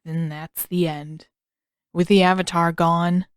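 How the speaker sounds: heavy-hearted elder giving up; gloomy sigh. A narrator's reading. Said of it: "a heavy sigh".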